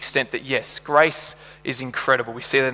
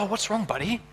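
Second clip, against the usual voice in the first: soft, higher concerned-dad voice; gentle dad; 6 semitones higher, 6 dB quieter than usual. Retold or imitated. Dad to his teary little boy, gentle concern. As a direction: check tenderly on a little boy.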